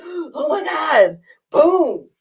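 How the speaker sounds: high, slow gasped words; winded exclamation. Retold or imitated. Patient hit by another push, winded exclamation. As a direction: act out the breath being knocked out. Gasps under repeated pressure.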